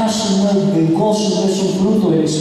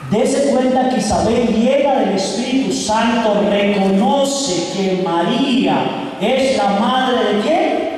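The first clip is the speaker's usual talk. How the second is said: raised, driving sermon voice; fervent preaching; 5 semitones higher than usual. Acted. Pastor urging the congregation, raised fervent preaching. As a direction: preach it up with growing fire. Rising preaching at full force.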